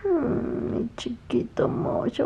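slow soft whisper into his ear. Real, not acted. Mother whispering to her baby at bedtime. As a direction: whisper it so he stays sleepy.

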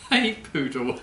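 repeats the punchline through a laugh. Real, not acted. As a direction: chuckle the answer back.

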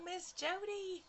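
high, slow, drawn-out name; sing-song hello. Real, not acted. Greets viewer Miss Jody, high and slow.

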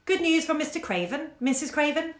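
high, lightly voiced old waiter; high-pitched solicitous waiter. A narrator's reading. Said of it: "the old waiter would say".

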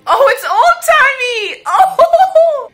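high, loud delighted cry; delighted shriek. Real, not acted. Viewer shrieks with glee at an old-timey scene, high and loud.

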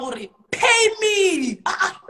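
slow, loud, high demand; telling her friend to pay up, slow and loud. Retold or imitated. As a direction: drag out each word and demand payment. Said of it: "I had to tell her".

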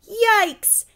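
very high, loud panicked cry; boy panicking at incoming bees; shouted. Acted. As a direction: scream a warning. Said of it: "shouted Arnold".

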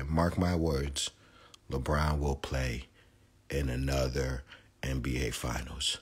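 slow, deliberate prediction; grave, measured vow; slow emphatic. Real, not acted. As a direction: spell it out word by word. Solemn prediction.

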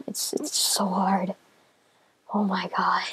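slow, soft whispered despair; defeated whisper. Real, not acted. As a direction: breathe it out, beaten. Frustrated muttering after dying.

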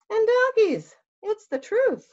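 high, loud voila; grand flourish. Acted. Fox promising Bear a donkey, high and showy. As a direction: finish like a magician's reveal. Fox's pitch peaks.

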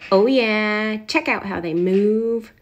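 ordinary delivery

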